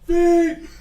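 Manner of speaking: loud, high call for Fink; high shouted search. Acted. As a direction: call across the town. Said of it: "he goes".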